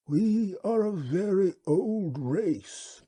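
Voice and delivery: slow, high, unhurried alien creature voice; wub's voice. Acted. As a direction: speak as a placid, courteous old beast. The wub speaks.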